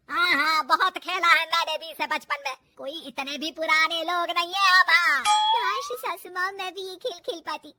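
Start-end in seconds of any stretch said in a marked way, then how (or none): 0.1-5.2 loud, indignant mother-in-law; touchy, forceful comeback; loud retort. Acted. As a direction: insist at full volume, put out. Saas retorts to giggling bahu.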